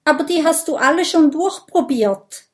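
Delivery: high, loud, hurried protest; desperate objection. A narrator's reading. Minimo objects that his family was all tested, desperate. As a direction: object in rising despair. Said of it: "erwidert Minimo verzweifelt".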